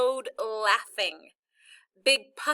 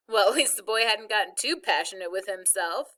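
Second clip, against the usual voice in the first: ordinary delivery, nothing marked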